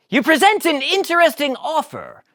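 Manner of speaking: high, loud scheming Newman; intrigued Newman. Acted. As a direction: weigh the offer.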